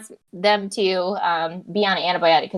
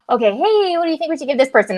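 higher, brighter, fast eager question; eager jump-in; 7 semitones higher, 4 dB louder than usual. Retold or imitated. Doctor jumping in to quiz the team, eager and fast. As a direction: pounce on the case with enthusiasm.

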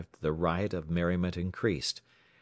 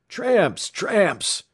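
very high, loud shrill cry; shrill, high-pitched yell; 13 semitones higher, 7 dB louder than usual. A narrator's reading. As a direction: shriek the alarm. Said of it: "he shrilled".

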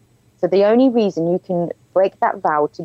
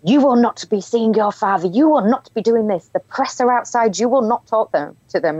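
stern policeman; 5 semitones higher than usual. Retold or imitated.

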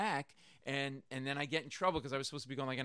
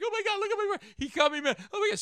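put-on high, loud girlfriend's wail; panicked girlfriend screaming over her cut boyfriend; retold screaming; 7 dB louder, faster than usual.

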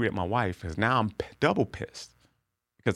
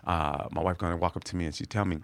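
ordinary talk

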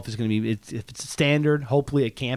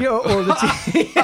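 laughing; 6 semitones higher, 4 dB louder, much slower than usual. Real, not acted.

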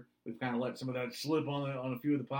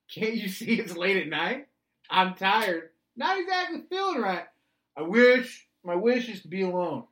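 yelling back at him; 4 semitones higher, 8 dB louder than usual. Retold or imitated. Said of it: "I'm screaming back at him".

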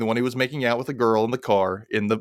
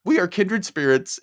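laughing delight; 7 semitones higher than usual. Real, not acted. Delighted shared hobby.

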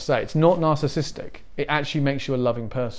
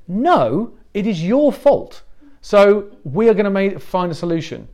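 loud firm English; 5 semitones higher, 4 dB louder than usual. Retold or imitated. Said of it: "very loudly for some reason, and it sounded very English".

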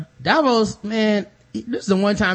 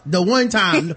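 ordinary talk

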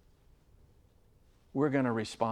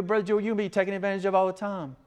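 rapid, higher-pitched protest; quick mock objection; 6 semitones higher, much faster than usual. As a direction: rattle it off as a worried listener. Acted objection from a listener.

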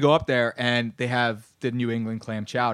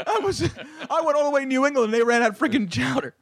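laughing indignation; 10 semitones higher than usual. Real, not acted. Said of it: "still laughing at own story".

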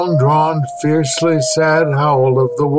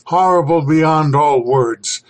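ordinary delivery

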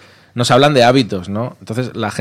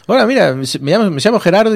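put-on higher, louder Argentine-accented greeting; 10 semitones higher, 4 dB louder, faster than usual. Real Gerardo phones the station, imitated with an Argentine accent. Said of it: "un día llamó".

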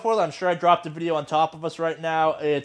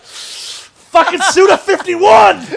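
much higher, loud, slow yell; delighted shout; 13 semitones higher, 13 dB louder, much slower than usual. Retold or imitated. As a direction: yell it out in geeky joy. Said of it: "And I'm like".

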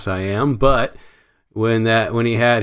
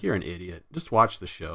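muted self-scolding; 2 semitones lower, 8 dB quieter than usual. Retold or imitated. Fan recounting telling himself off, softly chiding. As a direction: drop the volume, chide yourself.

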